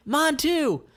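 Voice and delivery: high, keen; eager Mick. Acted. Mick ordering too, high and keen. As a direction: jump in eagerly.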